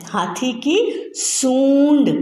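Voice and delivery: सूंड with a long ऊ and a nasal sound, said slowly; correct pronunciation, slow. Shown as a demonstration. Teacher models the correct word for children, slow. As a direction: say हाथी की सूंड slowly and clearly. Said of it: "हम क्या बोलते हैं?".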